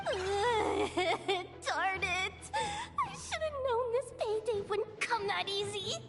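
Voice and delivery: hammed-up tearful wail; comic whining. Lamenting that this payday was not easy, mock-tearful. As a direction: whimper over your bad luck, half crying. Dismayed at hard-won payday.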